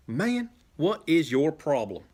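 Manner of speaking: slowed, heated outburst; livid. Acted. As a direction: confront a friend in anger. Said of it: "Adam is livid".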